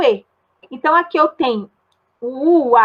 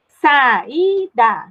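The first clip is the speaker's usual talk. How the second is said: "Saída" split into sa, í, da with clear gaps; teacher's syllable-by-syllable demo of "Saída". Shown as a demonstration. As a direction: slowly sound out each syllable of "Saída". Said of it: "como eu falo".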